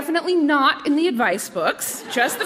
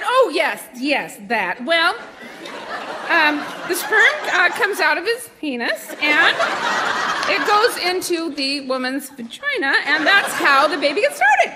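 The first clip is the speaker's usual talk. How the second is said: hesitant, over-casual parent explaining; forcefully casual; 4 semitones higher than usual. Retold or imitated. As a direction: act forcefully casual, stumbling over the awkward words. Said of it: "a forcefully casual pose".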